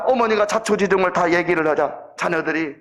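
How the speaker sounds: ordinary talk, nothing marked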